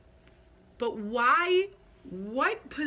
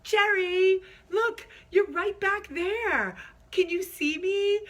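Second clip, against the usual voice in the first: put-on high, loud, drawn-out drunken holler; slow sloppy mock-drunk call; 11 semitones higher, 5 dB louder, slower than usual. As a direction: imitate your tipsy self shouting across a room. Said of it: "And I'm drunk. And I'm like".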